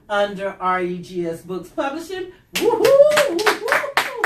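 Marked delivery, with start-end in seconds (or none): 2.6-3.3 high, loud woo-hoo; excited cheer. Real, not acted. Cheering for authors. 3.4-4.2 high woo-hoo cheer; excited cheer. Real, not acted. Cheering for authors.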